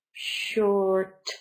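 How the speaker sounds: short with a long sh and stretched vowel; slow teaching model of short; drawn-out SH sound. Shown as a demonstration. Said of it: "the S H sound".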